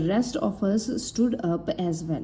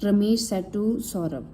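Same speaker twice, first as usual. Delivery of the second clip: ordinary delivery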